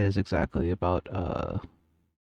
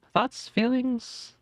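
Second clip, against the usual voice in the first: high squeaky prompt; 12 semitones higher, much slower than usual. Acted. Playful high-pitched prompt to chat.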